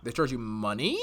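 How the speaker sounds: double-checking in shock; incredulous. Real, not acted. Host presses guest on being charged for drinks, incredulous rising question.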